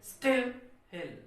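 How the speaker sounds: Still hit high and loud, हिल dropped; stress on first word. Shown as a demonstration. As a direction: punch still, let हिल fall away. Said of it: "कैसे बोलेंगे".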